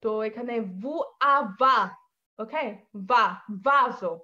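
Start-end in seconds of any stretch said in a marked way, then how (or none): 1.3-1.8 va said louder with a clear v sound, lips on teeth, not ভা; Italian V demo. Shown as a demonstration. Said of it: "বাংলাদেশিদের জন্য খুবই সমস্যাকর letter". 3.7-4.1 va said louder with a clear v sound, lips on teeth, not ভা; Italian V demo. Shown as a demonstration. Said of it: "বাংলাদেশিদের জন্য খুবই সমস্যাকর letter".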